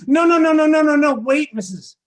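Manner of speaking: acted panicked plea, loud and high; frantic protest. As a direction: blurt out urgent, high-pitched no's to stop a misunderstanding.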